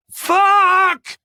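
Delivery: long, high, horrified yell; loud, high-pitched cry of alarm; shout. A narrator's reading. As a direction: shout one drawn-out curse in shock. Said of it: "shouted Warren".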